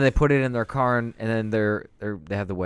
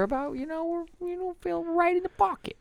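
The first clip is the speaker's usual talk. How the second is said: squeaky high-pitched chill voice; going squeaky while hyping up. Pretend. Playful hype voice.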